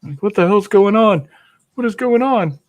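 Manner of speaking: high, loud confused puppy voice. Pretend. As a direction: squeak it out like a bewildered pup.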